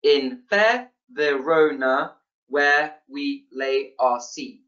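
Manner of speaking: slow, evenly separated syllables; counting out syllables. Shown as a demonstration. Lecturer counting ten syllables for students, syllable by syllable. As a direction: chop the line into single syllables, one beat each. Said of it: "Ten syllables".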